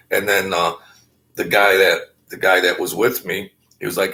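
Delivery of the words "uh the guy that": usual speaking voice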